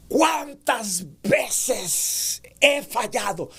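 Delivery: tono alto y lento, con enojo; enojo fingido. Shown as a demonstration. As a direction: levanta la voz como reprochándote. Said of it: "Estás levantando el tono porque estás expresando algo de un enojo". (pregunta con enojo, voz alzada)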